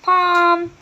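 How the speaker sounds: high, loud imitated horn; horn blast. A narrator's reading. Hetty blowing her horn at the dragon, a loud high "pom". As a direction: trumpet it like a horn.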